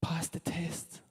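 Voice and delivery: slow unvoiced whisper of Pass the test. Shown as a demonstration. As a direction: breathe "Pass the test" slowly as an urgent secret. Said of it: "I cannot emphasize this enough".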